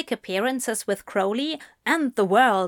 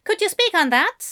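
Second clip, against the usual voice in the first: journalist voice; 10 semitones higher, 4 dB louder, faster than usual. A narrator's reading.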